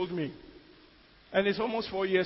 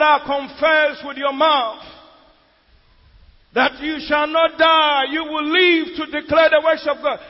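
loud pulpit proclamation, pitched up; preacher's loud declaration; 5 semitones higher, 7 dB louder than usual. Retold or imitated. Pastor mounting up, proclaiming to the church, loud declaration. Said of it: "then he will say that".

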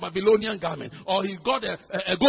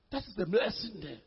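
usual speaking voice

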